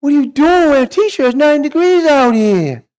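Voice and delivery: put-on high, loud kid's taunting voice. Kid at the beach mocking the T-shirt, high and loud. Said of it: "Some kid comes up to me. Goes".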